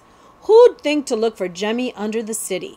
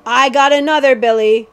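loud, raised cackle of a villain; cackling outlaw; 4 semitones higher, 8 dB louder than usual. A narrator's reading. Second cutthroat shows Billy his catch, cackling. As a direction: crow it loudly to a partner. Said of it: "cackled the second man".

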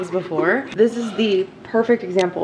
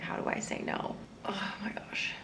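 whispering; 16 dB quieter than usual. Real, not acted. Whispered aside on street.

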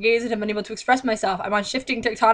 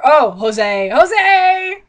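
mock calling out; 5 semitones higher, 7 dB louder, much slower than usual.

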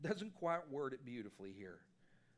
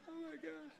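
mock panic; 12 semitones higher, 6 dB quieter than usual. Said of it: "I was like".

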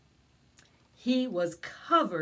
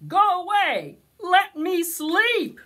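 loud, higher, slowed angry bear; grumpy bear; 11 semitones higher, 10 dB louder than usual. Acted. Unhappy Bear yells at his friends, loud. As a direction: roar it out in grumpy frustration. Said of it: "Bear wasn't happy".

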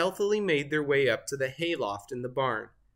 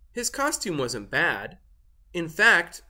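usual speaking voice

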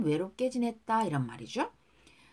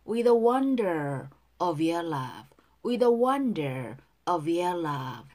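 slow, even teacher's model line; slow model reading; 3 semitones lower, 3 dB louder, much slower than usual. Shown as a demonstration. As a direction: spell each word out slowly. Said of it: "with 연음이 돼요". (천천히 또박또박 시범 발음)

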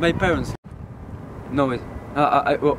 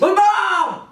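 loud, high plea to mother; desperate shout; 11 semitones higher, 4 dB louder than usual. Acted. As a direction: yell Mama, much higher.

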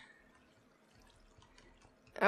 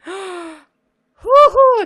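loud high cheer of victory; triumphant whoop; 9 semitones higher, 13 dB louder than usual. Real, not acted. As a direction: whoop as you finally make it. Gets into the exit.

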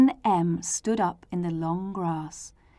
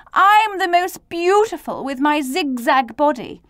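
lightly boastful, starting high and loud; 7 semitones higher, 5 dB louder than usual. Acted. Z pushes to the front of the letters, mildly boastful. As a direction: brag proudly, settling as it goes on.

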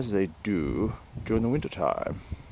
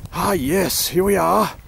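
excited; 8 semitones higher, 6 dB louder than usual. Acted. Arriving at the animal.

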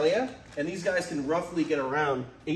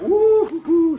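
high loud whoops; excited whoop; 11 dB louder, slower than usual. Real, not acted. Cobra flies out, he whoops.

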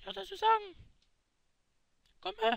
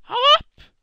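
angry shout; 6 semitones higher, 20 dB louder than usual. Acted. Chases Gomme away.

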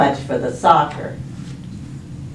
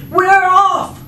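high, loud holler; yelling crew; 12 semitones higher, 6 dB louder than usual. Acted. Crew echoes captain as they set sail, yelling. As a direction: yell it as the whole crew. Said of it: "yelled the others".

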